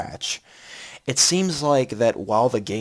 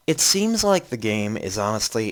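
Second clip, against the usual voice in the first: normal talking voice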